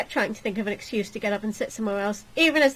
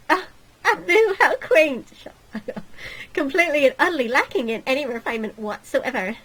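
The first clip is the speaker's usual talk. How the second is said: lightly voiced snooty royal; haughty princess; 5 semitones higher, 5 dB louder than usual. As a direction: look down your nose, prim.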